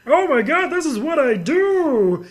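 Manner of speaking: high, loud, slow exclaiming; mock amazement. Player amazed watching the demo, high and loud. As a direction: act dazzled by the demo. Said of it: "the demo would show you".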